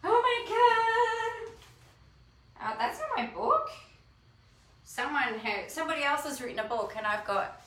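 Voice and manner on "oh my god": awed gasp. Real, not acted. Unboxing her own book.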